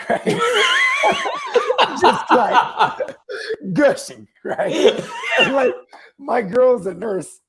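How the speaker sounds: high, loud words through laughter; real laughing. Real, not acted. Telling how his boy's nose bled, cracking up. Cracking up over nosebleed story.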